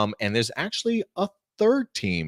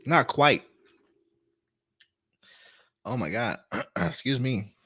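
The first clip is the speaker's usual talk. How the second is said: hoarse after choking. Real, not acted. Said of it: "I started choking for a minute".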